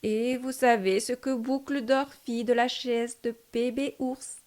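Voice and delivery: usual speaking voice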